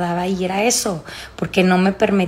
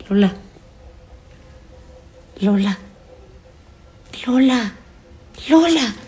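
calling out; 3 semitones higher than usual. Real, not acted. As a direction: call her name, rising higher. Calling the dog, louder and higher.

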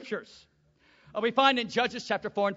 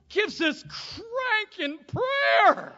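cranking prayer; 12 semitones higher, 6 dB louder, much slower than usual. Acted. Preacher mimics powerful prayer.

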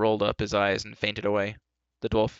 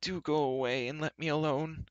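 higher-pitched, mildly tearful plea; acted whimpering complaint; sobbing; 6 semitones higher than usual. A narrator's reading. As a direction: wail it lightly, begging to be left alone. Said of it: "he sobbed".

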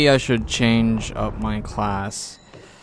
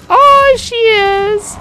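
high squeal; 10 dB louder, much slower than usual. Real, not acted. Squealed high drawn exclamation.

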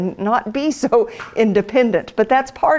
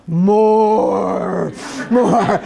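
growling stomach. Retold or imitated. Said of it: "makes my stomach go".

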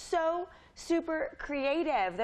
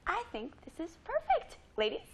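mock British accent; 6 semitones higher than usual. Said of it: "She'll have a British accent".

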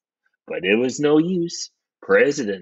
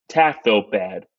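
ordinary delivery, nothing marked

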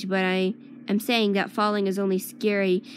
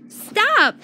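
loud high scream of stop; desperate scream of stop; 13 semitones higher, 7 dB louder than usual. A narrator's reading. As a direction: scream it to halt the fight. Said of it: "I scream".

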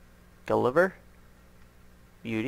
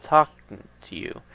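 ordinary talk

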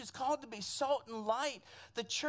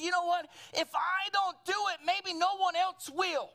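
raised, higher preaching voice; acted urgent conviction; 5 semitones higher, 4 dB louder than usual. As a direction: voice an inner call with force. Inner voice urging Nehemiah.

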